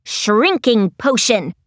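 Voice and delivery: low, urgent Lizzie call; mild shout. A narrator's reading. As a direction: call it out low, without much volume. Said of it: "Lizzie shouted".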